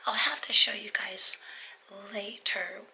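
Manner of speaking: hoarse whispered voice; whispering. Real, not acted. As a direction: whisper through a failing voice. Speaks with a damaged voice throughout.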